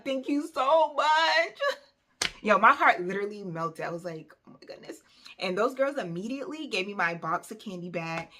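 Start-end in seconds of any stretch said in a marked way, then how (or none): 0.0-1.6 higher, louder and slower, gushing; gushing thanks. Retold or imitated. Thanking Keisha who got her candy back, gushing. 4.5-5.0 whispered oh my goodness; awed whisper. Retold or imitated. Moved after Keisha stood up for her, whispering. Heart melting at rescue.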